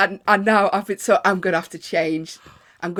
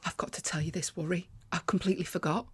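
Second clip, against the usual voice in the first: hushed, urgent whisper; 11 dB quieter than usual. Real, not acted. Whispering to the co-host before telling a secret.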